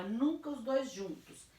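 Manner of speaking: ordinary delivery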